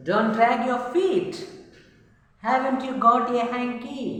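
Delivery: high, loud nagging parent; scolding grown-up. Acted. Teacher reading grown-ups' rules to pupils, high and loud. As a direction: fire off the rules like a fussy elder. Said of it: "they say".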